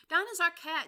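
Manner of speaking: high, loud gushing; eager. Acted. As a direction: blurt it out proudly. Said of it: "replied eagerly".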